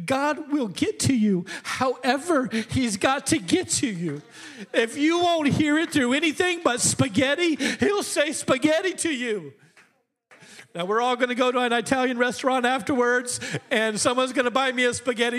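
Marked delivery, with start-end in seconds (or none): 0.1-9.5 high, tearful preaching; choked up. Real, not acted. Preacher moved by the story, near tears. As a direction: fight back tears as you press the point. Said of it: "I don't mean to cry".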